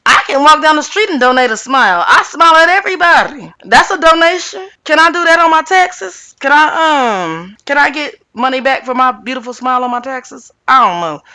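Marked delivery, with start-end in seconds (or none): 0.1-9.1 high, loud, quick-fire ridicule; mocking disbelief. Real, not acted. Host ridiculing donating a smile, in loud mocking disbelief. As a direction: raise the pitch and scoff at the idea.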